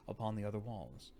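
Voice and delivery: plain reading voice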